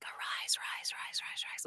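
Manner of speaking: rapid whispered repeats, barely any voice; hushed, repeating whispered chant; repetitive whispers. Shown as a demonstration. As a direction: whisper it over and over, overlapping like an echo. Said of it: "just repetitive whispers like".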